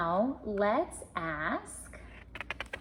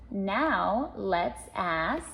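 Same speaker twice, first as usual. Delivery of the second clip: ordinary delivery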